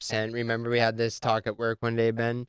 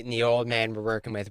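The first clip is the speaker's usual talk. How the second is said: ordinary talk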